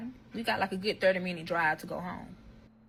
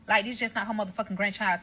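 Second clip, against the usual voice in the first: ordinary talk, nothing marked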